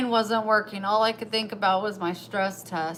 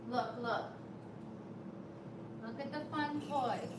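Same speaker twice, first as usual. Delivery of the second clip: soft, high, cooing encouragement to the cat; pet talk; 4 semitones higher, 14 dB quieter than usual. Real, not acted. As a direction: coax the cat to play, sweet and soft. Talking to her pet.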